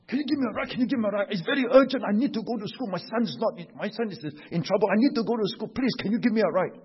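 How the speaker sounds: high, urgent pleading woman's voice; pleading stranger. Retold or imitated. Stranger at car window begging driver for urgent ride. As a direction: beg anxiously for a lift.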